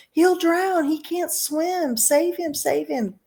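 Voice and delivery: high, frantic pleading; panicked screaming. A narrator's reading. Mother screaming for help as her son sinks. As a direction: beg for rescue in panic. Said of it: "screamed Missus Gloop".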